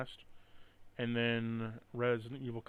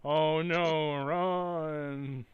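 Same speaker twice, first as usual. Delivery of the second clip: flat fake panic, a bit louder; mock panic; 4 semitones higher, 7 dB louder, much slower than usual. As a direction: ham up a bored scream. Mocking game character panic.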